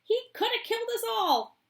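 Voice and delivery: put-on high old woman's outcry; outraged; Grandma Josephine. Grandma Josephine about Wonka, outraged.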